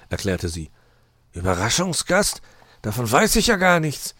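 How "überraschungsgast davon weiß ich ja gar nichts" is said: rising question, then high protest; puzzled, then raise the voice; taken aback. A narrator's reading. Fox is caught by surprise.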